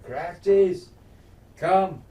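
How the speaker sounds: mock commanding call; retold yell. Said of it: "he yells for".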